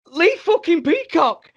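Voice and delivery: very high, slow, loud squeal; hammed-up squeal of disbelief; starstruck fan. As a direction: imitate an awestruck fanboy shrieking a name. Imitates starstruck friend.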